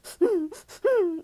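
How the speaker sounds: high-pitched mock hiccupping sob; imitated crying gasps. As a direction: suck in air like a pretend crybaby. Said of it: "he'd be like".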